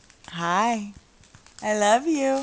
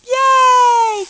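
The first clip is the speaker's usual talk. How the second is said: cheering; 8 dB louder than usual. Real, not acted. Cheering baby.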